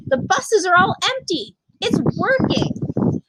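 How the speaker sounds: high, slow, excited wife at the window; wife's voice. Acted. Wife calling her husband to the window, high and excited.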